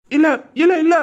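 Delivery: pained pleading. Acted. Beaten bully pleads no to the hero. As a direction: cry no while being beaten.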